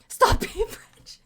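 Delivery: high, slow words dissolving into laughter; laughing. Real, not acted. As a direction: crack up as you say it. Cracks up at a chat comment.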